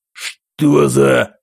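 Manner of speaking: stunned, loud half-question; loud cry that breaks off; shocked. Acted. General shocked, cut off. (ошеломлённый возглас)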